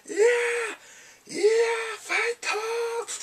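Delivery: loud falsetto war cries; mock excitement. Three guys in war outfits cheering him, hammed-up excitement. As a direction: holler it very high like hammed-up soldiers. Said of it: "every time they're excited for him".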